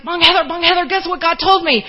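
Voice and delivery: high, loud, bursting with news; so excited. Retold or imitated. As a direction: imitate an excited young woman calling out. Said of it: "She was so excited to tell me".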